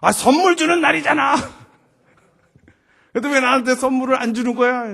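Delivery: 높고 크게 떼쓰듯 항의; 서운함을 빽 내지르는 딸 흉내; 불만 터뜨리는 딸. Retold or imitated. 선물 없다고 대드는 딸. (소리 높여 따지는 말투)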